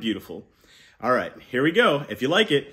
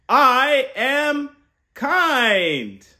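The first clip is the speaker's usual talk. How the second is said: very slow, loud and much higher; rousing call to repeat; 8 semitones higher, 5 dB louder, much slower than usual. Shown as a demonstration. Uncle leading kids to repeat, loud and slow. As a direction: stretch it big so kids join in. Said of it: "Count of three".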